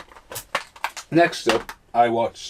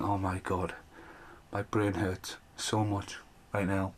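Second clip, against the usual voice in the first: soft and slow, like your head is throbbing; pained mutter; 2 semitones lower, 12 dB quieter, slower than usual. Retold or imitated. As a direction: mumble it quietly, worn out by the film. Viewer's pained inner reaction.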